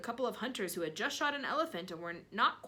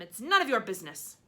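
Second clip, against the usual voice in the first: scolding; 2 semitones higher, 8 dB louder than usual. Acted. Aunt scolding boy.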